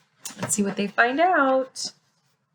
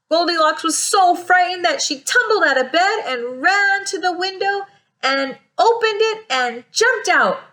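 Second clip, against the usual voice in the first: excited escape narration; 7 semitones higher than usual. A narrator's reading. Narrating Goldilocks's frightened escape.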